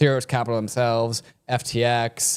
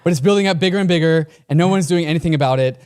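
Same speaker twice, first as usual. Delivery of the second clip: loud, higher hammed-up Trump, rushing the middle; Donald Trump impression; 4 semitones higher, 5 dB louder than usual. Reading Trump's Fox quote, loud mock Trump voice. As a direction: boast like Trump on TV, fast and loud. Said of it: "I'm, like, shit at impressions".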